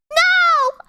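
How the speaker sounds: high, loud mock-terrified squeal; hammed-up frightened yell; scared shout. A narrator's reading. Heroine faces Voldemort, high scared shout. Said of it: "I shouted in a scared voice".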